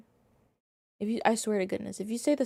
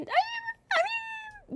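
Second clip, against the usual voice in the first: squeaky falsetto; much slower than usual. Real, not acted.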